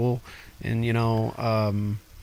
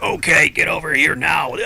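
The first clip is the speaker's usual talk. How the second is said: barking drill sergeant, louder and higher; drill instructor voice; 4 semitones higher, 5 dB louder than usual. Retold or imitated. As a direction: yell it like a boot camp drill instructor. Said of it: "that drill instructor voice".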